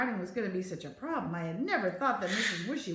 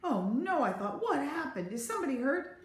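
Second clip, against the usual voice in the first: alarmed; 6 semitones higher than usual. A narrator's reading. Narrator's alarmed thought voiced.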